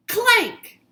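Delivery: shouted high clank; loud high sound effect clank. A narrator's reading. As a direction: bang it out like metal hitting.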